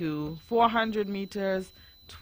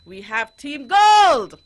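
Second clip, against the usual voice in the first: big, high, drawn-out announcer shout; slow, booming hype call; host voice; 8 semitones higher, 16 dB louder, slower than usual. Pretend. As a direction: introduce the team like an arena host. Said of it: "that was my host voice".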